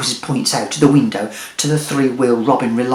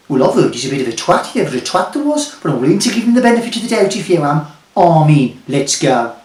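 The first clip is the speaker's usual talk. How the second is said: fast Jeremy voice; faster than usual. Acted. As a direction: rattle it off fast. Jeremy speaks quickly.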